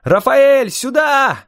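high-pitched urgent call across distance; yelling. A narrator's reading. Waving and calling Rafael over to the road, yelling. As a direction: yell for him to come over. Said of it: "орал я".

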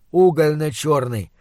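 usual speaking voice

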